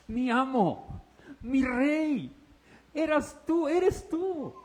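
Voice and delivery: high, rising awe; overwhelmed recognition of the Lord; awed Saul. Acted. Saulo reconoce al Señor. (Saulo asombrado, voz muy aguda)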